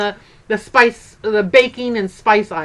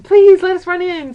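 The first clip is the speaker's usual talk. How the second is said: pleading; 8 semitones higher, 7 dB louder than usual. Retold or imitated. Begging to go into store.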